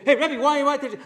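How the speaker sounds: high, loud puzzled student calling out; a boy blurting out in shock; student caught off guard, high and loud; surprised kid. Retold or imitated. Kids' surprised protest voiced.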